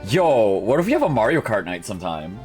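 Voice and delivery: ordinary talk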